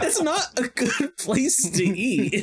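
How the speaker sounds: high and slow through a laugh. Retold or imitated. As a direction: laugh while saying it. Retold quote laughing.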